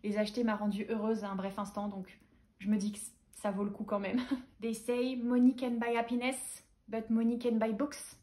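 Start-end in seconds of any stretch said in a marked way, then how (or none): 4.6-8.2 slow English with a French accent; English quip, drawn out in a French accent. Real, not acted. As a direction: recite it slowly in shaky French-accented English. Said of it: "Avec mon accent anglais moisi".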